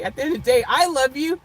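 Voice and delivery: ordinary talk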